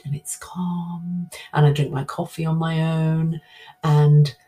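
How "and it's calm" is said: calm. Acted. Describing her calm solitude.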